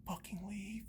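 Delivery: whispered dismissal; bitter whisper. Real, not acted. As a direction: drop to a contemptuous whisper. Bitter end of rant.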